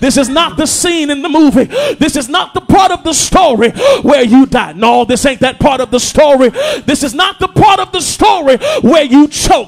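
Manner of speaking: full-force Sunday preaching. Acted. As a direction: push the words out over the crowd. Preacher at peak intensity.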